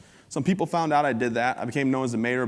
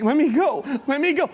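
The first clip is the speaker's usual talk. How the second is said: high-pitched, louder panicked squeal; squealing boyfriend; 6 dB louder than usual. Retold or imitated. Boyfriend begging the dad to let go, high squeal. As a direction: squeal like a terrified teenager begging. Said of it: "He's like".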